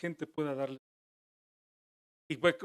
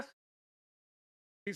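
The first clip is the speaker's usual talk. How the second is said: usual speaking voice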